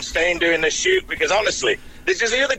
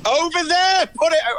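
real high-pitched yell; shouting; 11 semitones higher than usual. Real, not acted. Man breaking off his call to yell directions, shouting. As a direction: shout an order across the distance. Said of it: "What's he shouting at?".

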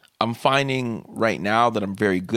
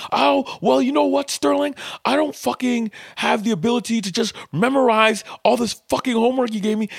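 mock angry yell; 12 semitones higher than usual. Student cussing out the teacher, high mocking angry yell. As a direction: rant back in a put-on student voice. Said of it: "kept yelling at me".

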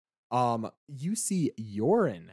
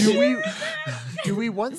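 laughing; 4 dB louder than usual. Real, not acted. Pun told while laughing.

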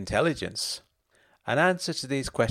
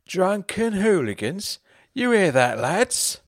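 higher, louder tipsy greeting; drunk voice; 10 semitones higher, 6 dB louder, slower than usual. Acted. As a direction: slur it sweetly like a drunk husband. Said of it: "still in his drunk voice".

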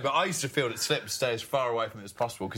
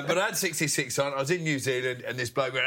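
usual speaking voice

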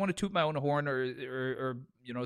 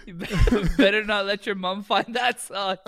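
high and loud, laughter breaking in; laughing quote; 6 semitones higher, 6 dB louder than usual. Retold or imitated. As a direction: tease through laughter. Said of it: "I said".

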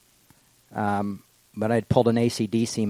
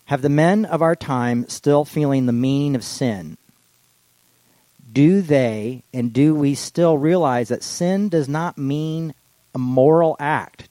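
fervent sermon reading; 5 semitones higher, 7 dB louder, slower than usual. Acted. Reads Tillich sermon aloud.

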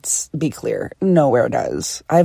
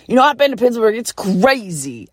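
singer's voice from the stage; 5 semitones higher than usual. Retold or imitated. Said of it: "I hear".

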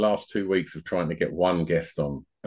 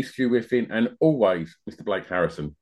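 ordinary talk, nothing marked

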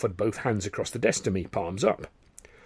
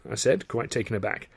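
ordinary delivery

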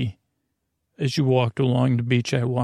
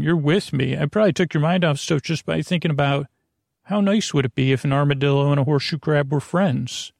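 ordinary talk

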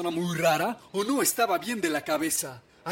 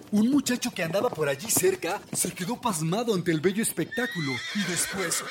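ordinary delivery, nothing marked

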